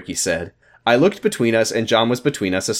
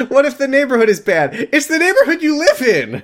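high mocking squeal; 12 semitones higher than usual. Mocking reread of line.

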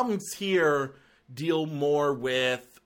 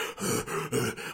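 mock gasp; 5 dB quieter than usual. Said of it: "who starts off like".

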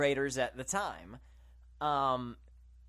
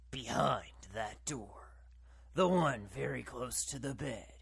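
spooky voice; 2 semitones lower, 3 dB quieter than usual. Retold or imitated. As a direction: tease the listener like a horror trailer. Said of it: "there's this spooky voice that's like".

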